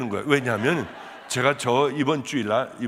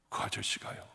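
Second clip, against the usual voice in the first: whisper; 10 dB quieter than usual. Retold or imitated. Hushed telling of night scene.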